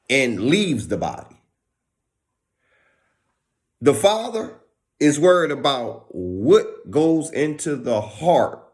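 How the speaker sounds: usual speaking voice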